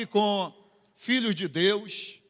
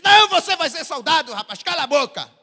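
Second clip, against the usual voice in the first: high, loud, fast imitated bark; mock commander shouting down a recruit; army commander; 6 semitones higher, 8 dB louder, faster than usual. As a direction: cut the recruit off loudly. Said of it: "ele disse".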